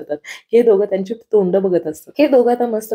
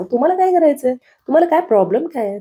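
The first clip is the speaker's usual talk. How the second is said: indignant retort; 7 semitones higher, faster than usual. Acted. Wife snapping at her husband for mocking her laugh, indignant and sharp. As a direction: bristle back at the teasing.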